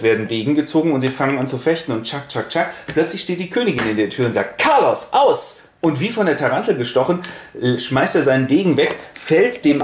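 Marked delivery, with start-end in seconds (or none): 4.6-5.6 high sharp command; commanding the prince like a dog. Retold or imitated. Queen in the doorway stops the fight, high sharp command. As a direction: call him off like a dog owner.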